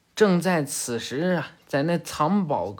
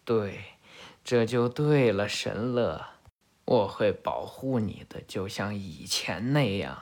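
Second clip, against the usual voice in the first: lower, softer, coaxing murmur; soothing persuasion; 7 semitones lower, 5 dB quieter than usual. Acted. Ishiki coaxing Kagura to trust him, low soft coaxing. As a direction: soothe her back to your side, low and soft.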